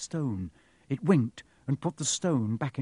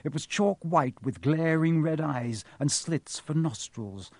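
ordinary delivery

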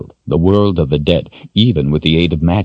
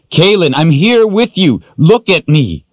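frantic shout; 12 semitones higher, 5 dB louder than usual. Acted. Richard shouting to reach her.

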